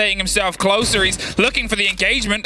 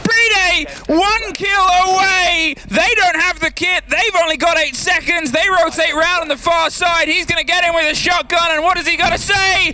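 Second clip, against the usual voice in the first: esports caster; 7 semitones higher than usual. Real, not acted. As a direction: build to a shouted climax. Caster calls the final clutch.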